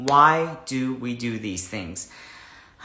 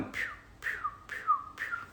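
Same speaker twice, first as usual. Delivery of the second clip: pew sound effect; 15 dB quieter, faster than usual. Real, not acted. Imitates hair sticking out.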